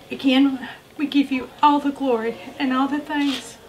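soft, reverent prayer voice. Real, not acted. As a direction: pray quietly and earnestly. Leads a prayer.

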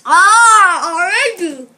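loud high yelp; pained shout. Real, not acted. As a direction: cry out at the sudden sourness. Sour candy hits at once.

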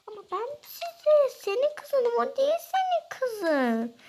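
put-on very high, slow little-girl plea; pleading child. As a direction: beg mum to see who her real daughter is. Real daughter pleading with mother.

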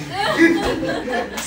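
high loud burst of laughter; big laugh. Real, not acted. Laugh after the yell.

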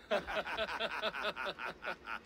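put-on warm, full laugh at an old prank; two old friends laughing together; hearty laugh. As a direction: laugh along at the memory. Shared memory of a prank.

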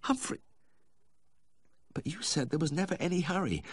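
high-pitched, puzzled wife's voice. Acted. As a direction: question it in a light, high voice. Sleepy wife queries vet.

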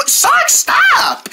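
high-pitched cut-in; exasperated outburst. Pretend. Sister interrupts Sark wanting to go home, exasperated. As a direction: cry out to stop the worrier. Sibling cuts off worrier.

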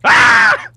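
short, very loud scream; sudden yell of fright; startled scream. Real, not acted.

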